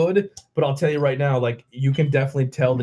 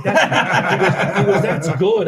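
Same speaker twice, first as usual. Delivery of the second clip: higher, louder, laughing through the words; a guy giggling at how good the beer is; laughing delight; 7 semitones higher, 5 dB louder, slower than usual. Retold or imitated. Bar stranger laughing as he tastes the strong beer. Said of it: "he's like".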